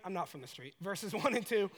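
ordinary delivery

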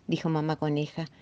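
ordinary delivery, nothing marked

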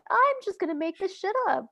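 high, airy mock self-talk; mock writer's inner voice. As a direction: toss it off like a cheeky writer cutting corners. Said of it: "and sometimes I'm like".

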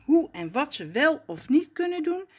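ordinary delivery

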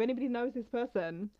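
usual speaking voice

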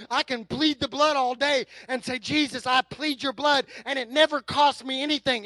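loud, driving sermon delivery; forceful preaching. Real, not acted. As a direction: pound out each parallel phrase loudly.

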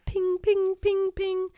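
very high little pings; tiny ringing pops in a row; ping them high and evenly; pinging noise. Acted. Voicing spiders shrinking.

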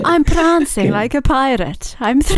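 Welsh riddle creature. Acted. Teased host plays riddle creature to guests in Welsh lilt. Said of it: "a riddle creature".

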